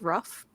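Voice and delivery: "rough" with a short uh vowel, standing for roof; husband's pronunciation of roof. Retold or imitated. Speaker quoting a New York husband, "rough" for roof. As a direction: say roof as "rough", rhyming with cuff. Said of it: "He says roof as rough".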